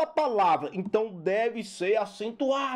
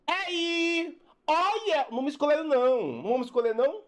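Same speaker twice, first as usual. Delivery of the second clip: back-row student; 4 semitones higher, faster than usual. Retold or imitated. Back-row pupil not picked for group work, calling out. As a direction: call out high, like a pupil left out. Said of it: "O aluno do fundão diz".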